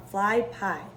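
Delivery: usual speaking voice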